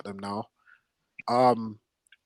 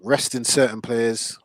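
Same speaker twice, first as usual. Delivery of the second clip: ordinary delivery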